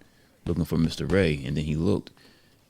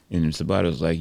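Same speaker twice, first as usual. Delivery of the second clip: usual speaking voice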